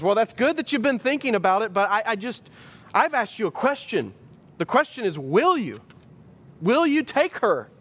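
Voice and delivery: an impatient pastor who won't let it go; exasperated minister. Retold or imitated. Minister presses a stalling groom.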